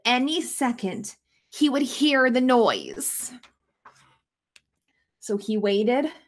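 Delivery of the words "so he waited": ordinary delivery, nothing marked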